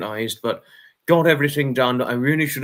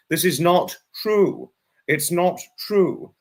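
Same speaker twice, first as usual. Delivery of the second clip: slow, emphatic, higher denial; slow firm denial; 4 semitones higher, slower than usual. Real, not acted. Streamer to lurkers, slow emphatic denial. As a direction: stamp each word down slowly. Insisting against accusation.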